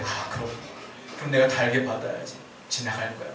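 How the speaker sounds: low, subdued penitent murmur; acted repentance. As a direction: accept the blame quietly, as if praying. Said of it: "회개하고 넘어가는 거예요".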